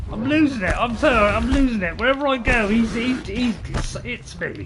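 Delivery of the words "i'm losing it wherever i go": usual speaking voice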